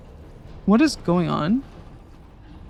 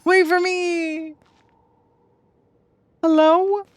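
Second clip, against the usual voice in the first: calling out; 8 semitones higher, slower than usual. Real, not acted. Calling after her party.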